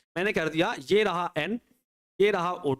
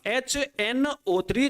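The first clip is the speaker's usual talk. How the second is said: HNO three drawn out slowly, higher; slow, spelled-out repeat; 5 semitones higher, much slower than usual. Shown as a demonstration. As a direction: stretch each letter of HNO three. Teacher repeats the acid slowly, emphatically.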